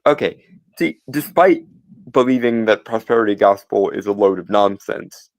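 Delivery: ordinary talk, nothing marked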